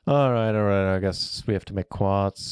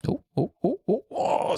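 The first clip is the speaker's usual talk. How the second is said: excited gasps; 12 semitones higher than usual. Real, not acted. Card almost lands.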